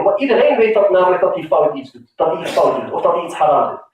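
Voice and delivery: normal talking voice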